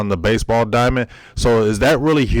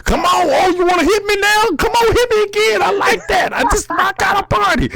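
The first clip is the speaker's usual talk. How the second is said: cocky taunting shout. Pretend. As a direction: taunt an opponent to hit you. Taunting imagined opponent, hyped.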